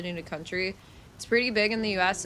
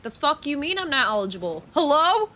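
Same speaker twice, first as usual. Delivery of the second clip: indignant retort; 6 semitones higher, 4 dB louder than usual. Retold or imitated. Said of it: "I'm like".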